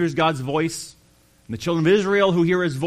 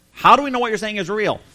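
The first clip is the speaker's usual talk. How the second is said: higher, louder, fast skeptical challenge; challenging demand; 7 semitones higher, 6 dB louder, faster than usual. Retold or imitated. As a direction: fire the doubt back at him. Pharisees challenging Jesus.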